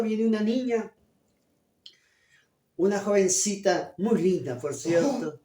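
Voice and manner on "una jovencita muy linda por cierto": ordinary talk, nothing marked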